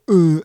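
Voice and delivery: loud mock caveman grunt.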